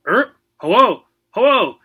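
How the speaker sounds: high, loud squawking parrot voice; shrill bird squawk greeting; shrieking parrot. A narrator's reading. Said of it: "shrieked a high-pitched voice".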